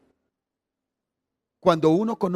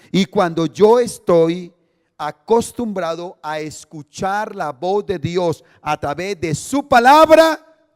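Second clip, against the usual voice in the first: slowing down and swelling louder to a peak; building preacher's climax; 8 dB louder, slower than usual. Acted. Pastor driving home the point, slow and rising loud. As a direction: stretch each word as the voice climbs. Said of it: "builds to climax".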